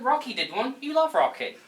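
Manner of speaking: ordinary delivery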